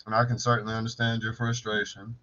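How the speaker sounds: low, subdued customer-service voice; impersonating calm coworker. Retold or imitated. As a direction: imitate a coworker coolly placating an angry caller. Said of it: "I got to do a perfect impersonation".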